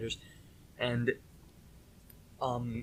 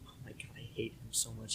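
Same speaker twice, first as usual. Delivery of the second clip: quick hushed aside; whisper; 12 dB quieter, faster than usual. Real, not acted. Telling cohost about the kid, a fast whispered aside. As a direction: hiss it under your breath.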